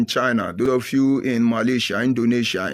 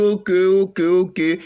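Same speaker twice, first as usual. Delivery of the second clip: high, louder okay okay of realisation; mock sudden recognition; 8 semitones higher, 4 dB louder than usual. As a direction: burst out as if it suddenly clicks. Villagers recognising him.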